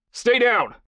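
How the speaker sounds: high, loud shout; barked order. A narrator's reading. Kai to his struggling captive in the car, barking. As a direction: bark a sharp command. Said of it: "Kai barked".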